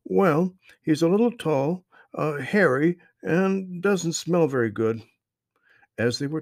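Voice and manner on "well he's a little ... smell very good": put-on high, loud friendly boy; young boy voice. A narrator's reading. Strange boy greets the lost children, high friendly voice. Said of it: "the boy".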